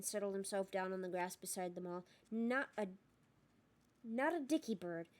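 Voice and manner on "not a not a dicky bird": untroubled, easy-going reply; comfortable. A narrator's reading. Bagman answering about missing Bertha, comfortable. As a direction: shrug off the worry lightly. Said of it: "said Bagman comfortably".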